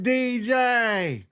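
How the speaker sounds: hyped call to the DJ. Retold or imitated. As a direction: shout across the club at the DJ. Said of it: "She said".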